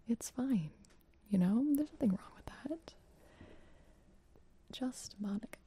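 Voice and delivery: breathy close-mic whisper. Real, not acted. ASMR charity stream whisper.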